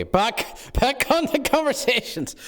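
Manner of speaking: high, squeaky interjection; flustered redirection. Pretend. Steering the talk back after being teased, high flustered squeak. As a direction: pitch shoots up into a flustered squeak.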